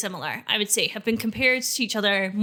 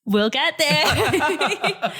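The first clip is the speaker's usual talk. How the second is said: slow, laughing drawn-out line; 4 semitones higher, 4 dB louder, much slower than usual. Real, not acted. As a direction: say it through a laugh.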